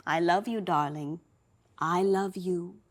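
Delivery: soft, low, loving goodbye; tender mother. Acted.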